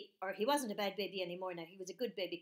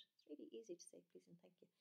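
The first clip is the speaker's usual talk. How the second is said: whisper; 3 semitones lower, 20 dB quieter, faster than usual. Acted. Narrator's soft aside to children.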